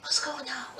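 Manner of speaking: very soft, low hush; mock furtive neighbor. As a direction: murmur like a neighbor secretly listening in. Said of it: "I bet they were like this".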